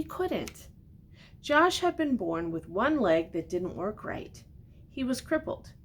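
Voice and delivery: put-on gentle read-aloud to a child; storybook reading. A narrator's reading. Reads picture book aloud.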